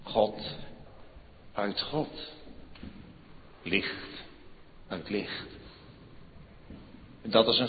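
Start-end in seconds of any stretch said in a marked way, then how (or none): 0.0-5.5 softer, awed hush. Acted. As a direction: hush it in awe. Hushed creed words. (zacht en eerbiedig)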